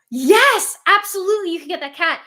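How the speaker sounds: loud, high, emphatic yes; eager agreement. Retold or imitated. Tattoo artist happily agreeing to the cat design, eager. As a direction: imitate someone gushing approval.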